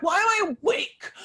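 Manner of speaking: put-on whiny little kid. Woman imitating herself woken up like a bratty kid. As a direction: shout it like a kid yanked from sleep. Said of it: "like a little kid".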